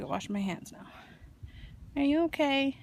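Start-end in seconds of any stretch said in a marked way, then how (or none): 2.0-2.8 coaxing a wild bird. Real, not acted.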